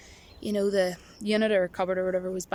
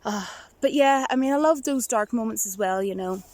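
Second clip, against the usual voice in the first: normal talking voice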